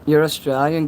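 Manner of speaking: usual speaking voice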